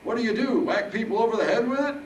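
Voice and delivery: put-on high-pitched, loud old-man teasing; cheeky old Harold. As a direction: rib him as high-voiced Harold.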